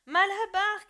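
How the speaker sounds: high, loud call; shouting a name. Acted. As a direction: call after the van with all your force. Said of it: "criait-il".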